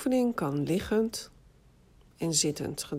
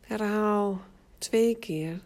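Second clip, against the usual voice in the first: guided meditation. Acted. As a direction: guide them into rest, unhurried. Leads autogenic relaxation exercise. (rustige meditatiestem)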